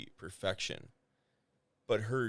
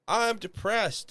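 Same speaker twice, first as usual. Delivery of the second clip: higher, louder, slow mock moan; mock melodramatic; 12 semitones higher, 7 dB louder, slower than usual. Writer mocks a character announcing depression, hammed-up slow whine. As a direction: ham up a character stating their sadness. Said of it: "someone being like".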